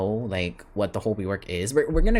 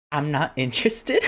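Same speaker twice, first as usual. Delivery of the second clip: laughing; 5 semitones higher, 3 dB louder than usual. Real, not acted. Laughing admission of disliking rework.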